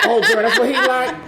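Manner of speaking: high-pitched mock girlfriend chatter; imitating excited women. Guest mimicking women who see his post, high mock excitement. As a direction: voice a gossiping girl, half laughing. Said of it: "they be like".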